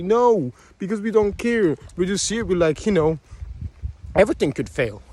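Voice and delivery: normal talking voice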